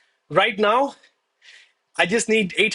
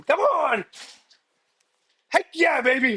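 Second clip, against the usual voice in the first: excited cheer; 8 semitones higher than usual. Real, not acted. Celebrating a winning trade live.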